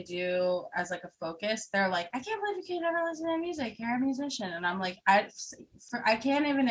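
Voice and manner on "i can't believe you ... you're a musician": imitated disbelief, high, quick and a little hushed; mock disbelief. Non-musicians questioning a musician, mock incredulous. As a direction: mimic incredulous people in a higher, faster voice.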